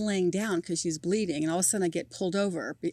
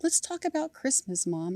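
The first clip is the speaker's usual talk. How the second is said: calming; 7 semitones higher, 5 dB quieter than usual. Retold or imitated. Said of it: "she's calming me down".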